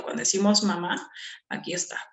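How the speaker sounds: ordinary talk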